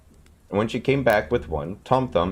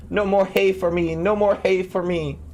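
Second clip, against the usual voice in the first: desperate cry; 12 semitones higher than usual. A narrator's reading. Tom Thumb in the cow's stomach, high slow desperate cry. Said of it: "cried out again".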